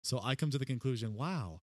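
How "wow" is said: drawn-out mimicked wow; Owen Wilson. Mocking an actor who always sounds alike, imitated wow. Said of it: "Let's, like, Owen Wilson".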